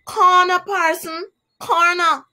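high, loud squawking parrot cry; shrill parrot squawk, high and loud. Acted. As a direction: squawk it out like a bird. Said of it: "the parrot cried out".

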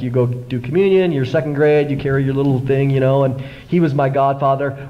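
ordinary talk

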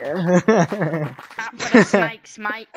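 low, loud grandpa warning. Pretend. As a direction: boom it out deep like grandpa. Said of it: "old grandpa voice".

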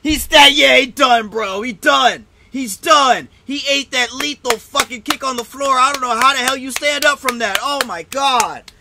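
loud, high-pitched disbelief after a knockout; stunned excitement. Real, not acted. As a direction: still fired up, marvelling at the finish. Reacting to the knockout.

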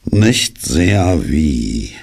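very slow, dragged-out reply; heavy, drawn-out dejected voice; gloomy donkey. Acted. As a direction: drag every word out dismally. Gloomy donkey answers Pooh.

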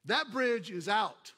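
loud, slow shout of warning; slow booming warning. Acted. Sermon opener, shouted slowly.

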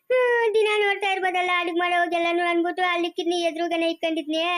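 long weepy sing-song explanation; tearful lament. Acted. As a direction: explain while wailing. Weeping woman explains hiding place. (ಗೋಳಾಡುತ್ತಾ ವಿವರಿಸುವುದು)

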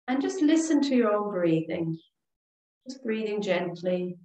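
calm, soothing relaxation voice with long pauses; guided meditation. Real, not acted. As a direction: guide them gently through each breath, leaving space. Leads breathing and meditation.

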